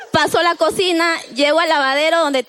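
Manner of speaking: ordinary delivery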